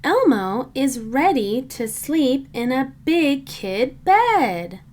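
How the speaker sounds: ordinary delivery, nothing marked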